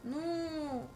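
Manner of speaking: normal talking voice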